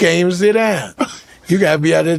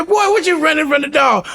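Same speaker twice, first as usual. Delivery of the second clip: teasing shout; 8 semitones higher than usual. Retold or imitated. Teasing scared son.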